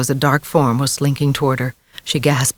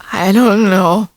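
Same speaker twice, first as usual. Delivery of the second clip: higher, louder tearful cry; a touch of a crying boy; wailing; 5 semitones higher, 4 dB louder, slower than usual. A narrator's reading. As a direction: wail it through tears. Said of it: "Joey wailed".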